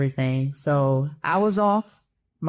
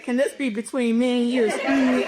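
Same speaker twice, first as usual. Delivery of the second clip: sheepish laughing plea; 7 semitones higher than usual. Retold or imitated. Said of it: "I said".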